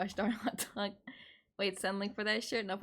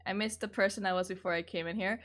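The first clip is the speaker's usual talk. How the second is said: usual speaking voice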